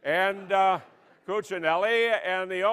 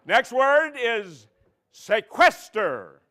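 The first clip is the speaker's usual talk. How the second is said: slow, louder word reading; slow announcer; 2 semitones higher, 4 dB louder, slower than usual. Pretend. Host giving next word, slow and loud. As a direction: stretch each syllable for the contestant.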